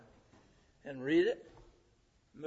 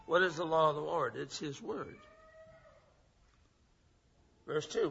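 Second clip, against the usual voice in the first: ordinary talk, nothing marked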